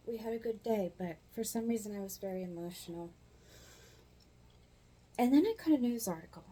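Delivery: ordinary talk, nothing marked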